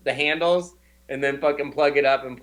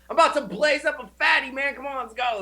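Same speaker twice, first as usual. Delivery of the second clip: much higher, fast, hyped American party voice; exaggerated American bro; 9 semitones higher than usual. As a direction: rush it like an overexcited American stoner.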